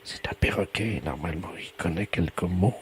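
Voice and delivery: hushed, half-whispered explanation; speaking very softly. Pretend. Researcher coaches caller to coax a parrot, hushed. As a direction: keep it down for a shy bird. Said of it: "parler tout doucement".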